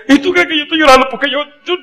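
put-on high, fast child's voice through tears; crying little boy. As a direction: blubber like a weeping kid. (niño llorando, voz muy aguda y rápida)

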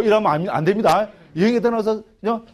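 usual speaking voice

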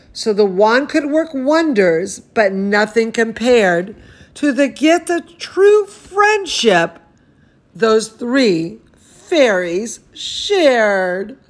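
lively storybook reading voice; animated picture-book narration; reading to children. Acted. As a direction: read it like bedtime story time for a toddler. Reads picture book aloud.

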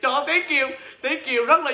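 high, laughing delight; overjoyed. Real, not acted. Seller thrilled by a caller's support, overjoyed and laughing. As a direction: burst out happily, breaking into laughter. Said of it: "kia gì mà sung sướng".

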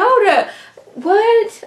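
high-pitched disbelief; shocked reaction. Retold or imitated. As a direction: play it like the news just hit. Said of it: "I'm like".

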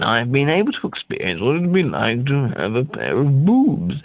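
ordinary talk, nothing marked

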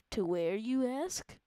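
slow, weighty announcement; dramatic reveal. Acted. Telling viewers where they're headed, slow and dramatic. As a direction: drag it out like a movie trailer.

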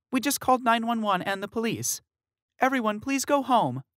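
high, firmer repeated request; calm manager. Acted. Manager sending guests home, firm but polite. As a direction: insist calmly they leave.

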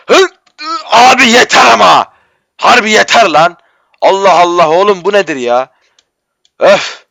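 high, loud cries of exasperation; fed-up whining yell; exasperated outburst. Real, not acted. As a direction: blow up at the game. Keeps failing the jump.

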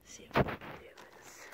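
ordinary delivery

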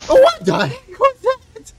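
high, slow, shaken outburst; shocked. Real, not acted. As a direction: gasp it out after the scare. After being chased screaming.

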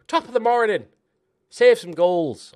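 loud, high mock Irish accent; bad Irish accent. Gamer geeing up his keeper, bad Irish accent. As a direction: shout it in a poor Irish accent. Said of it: "I can't do Irish accent".

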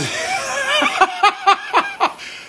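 long, high peals of laughter; real belly laugh. Real, not acted. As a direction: crack up helplessly at a joke. Cracks up at a viewer's comment.

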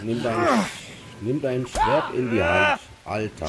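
loud, excited call to arms; urgent shout. Real, not acted. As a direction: yell at him to fight. Urging during sudden fight.

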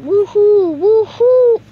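high, loud whoops; excited whoops. Real, not acted. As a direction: cheer while bouncing on the ball. Bouncing on yoga ball.

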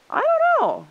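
very high, loud hedging; high-pitched doubtful protest. A narrator's reading. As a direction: squeal out your reluctance. Humans reluctant to trade back.

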